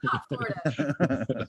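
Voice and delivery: announcer voice. Pretend. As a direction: read it like a radio slogan. Slogan read like a radio spot.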